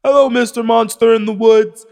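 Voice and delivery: high mocking call to the hidden monster; teasing sing-song voice. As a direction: coax the monster playfully in a high voice.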